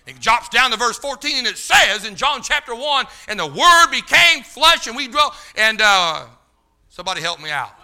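high, loud, driving scripture preaching; fiery preaching. Acted. Preacher proclaiming John chapter one to the church, fiery. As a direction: thunder the verse out.

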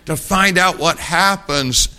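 mock-whiny boy unable to wait; impatient kid.

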